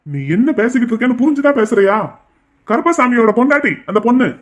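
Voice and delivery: loud, fast, heated scolding; father loudly rebuking his daughter; angry rebuke. Acted. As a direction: cut her off with a sharp loud rebuke.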